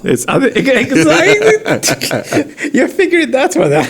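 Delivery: high, slow laughing words. Real, not acted. Laughing agreement.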